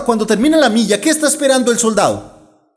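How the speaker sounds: ordinary talk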